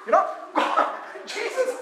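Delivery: usual speaking voice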